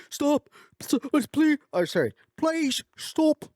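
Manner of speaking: panicked lab scientist begging the activists, high and loud. Retold or imitated. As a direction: squeal out a frantic plea to stop. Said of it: "begging them not to do it".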